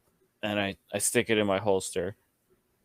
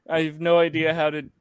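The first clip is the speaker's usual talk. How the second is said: normal talking voice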